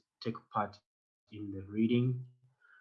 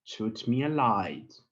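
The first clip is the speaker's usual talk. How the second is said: slow model reading; much slower than usual. Shown as a demonstration. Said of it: "read after me".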